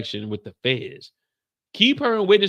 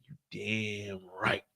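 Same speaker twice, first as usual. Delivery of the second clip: much softer, slow unvoiced whisper; cold whisper; 5 semitones lower, 12 dB quieter, much slower than usual. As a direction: breathe it out menacingly. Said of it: "He said".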